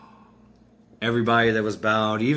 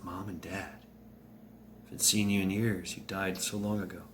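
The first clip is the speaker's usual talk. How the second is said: subdued, much softer voice; hushed recognition; 2 semitones lower, 8 dB quieter than usual. Acted. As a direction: say it quietly to long-lost parents. Bruce sees his long-dead parents.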